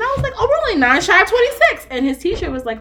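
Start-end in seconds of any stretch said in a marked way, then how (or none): 0.4-1.9 high, loud delighted realization; thrilled mom reaction, retold; retold excitement.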